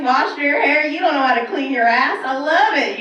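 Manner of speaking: ordinary talk, nothing marked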